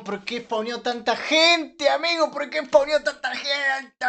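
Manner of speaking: yelling at the game in disbelief; frustrated shout. Real, not acted. Gamer yelling at the screen as crowds spawn, outraged shout. Game goes wrong, streamer yells.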